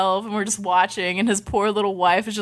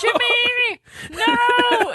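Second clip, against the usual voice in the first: put-on old wife calling out; much slower than usual. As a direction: call after him like a frantic old wife. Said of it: "his poor old little wife would come after him and be like".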